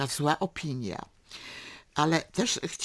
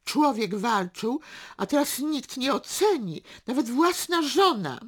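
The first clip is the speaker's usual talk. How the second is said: high, loud aggrieved lament; wounded complaint in a raised pitch; self-pitying; 7 semitones higher, 5 dB louder than usual. A narrator's reading. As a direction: wallow in feeling unappreciated. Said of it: "rozczulił się nagle nad sobą".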